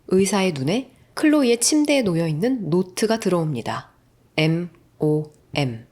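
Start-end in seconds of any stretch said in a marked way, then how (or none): 4.4-5.9 spelled out slowly. A narrator's reading. Spelling out the letters on note.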